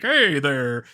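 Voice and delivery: lightly hammed-up celebrity cameo with a fake laugh; cheesy theme-park greeter. Host mimicking celebrities on a cheap ride screen, mock cheery greeting. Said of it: "talking to me on it, like".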